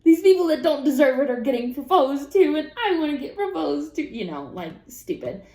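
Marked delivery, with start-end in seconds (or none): 0.0-4.0 much higher and louder frustrated whine; jokingly jealous rant about proposals; petulant whine. Retold or imitated. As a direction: stomp your foot in mock frustration. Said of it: "it's just like".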